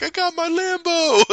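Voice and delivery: very high, giddy squeal; mock gleeful boast. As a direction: squeal it like a kid showing off. Voicing gleeful character in toy car.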